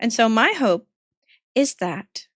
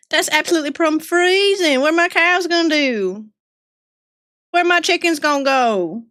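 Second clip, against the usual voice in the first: put-on Southern accent. Streamer as a Southern farmer fretting about the freeze. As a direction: worry over the cows in a twang. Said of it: "The accent's back".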